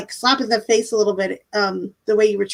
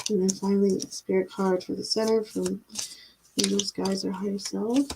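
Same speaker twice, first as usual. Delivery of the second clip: soft invocation to spirit; 6 dB quieter than usual. Acted. Reader quietly calling spirit guides for the card draw. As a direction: softly invite the spirits in, unhurried, hushed.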